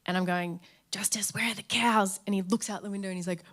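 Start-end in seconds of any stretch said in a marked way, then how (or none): 0.9-2.2 put-on hushed whisper to a sleepy baby. Mum whispering to baby Justice at bedtime, soft and hushed. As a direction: breathe it gently so he won't startle.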